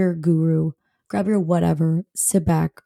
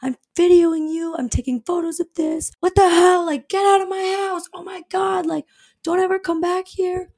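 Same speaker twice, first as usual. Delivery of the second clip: high-pitched imitation of a screaming neighbor; high mock yelling; 9 semitones higher than usual. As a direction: mimic an upset woman yelling, pitched way up without full volume. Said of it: "She's screaming".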